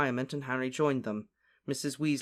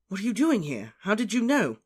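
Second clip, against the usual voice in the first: surprised; 7 semitones higher, 4 dB louder than usual. Acted. Harry surprised to see them.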